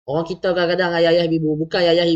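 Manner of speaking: ordinary delivery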